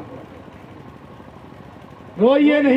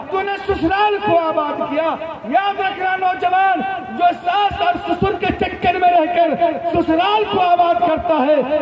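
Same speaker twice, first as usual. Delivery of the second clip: high, relentless sermon cadence; impassioned preaching; 8 semitones higher than usual. Acted. Preacher rebuking young men who forget parents, impassioned. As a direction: drive each phrase home in a raised preaching voice.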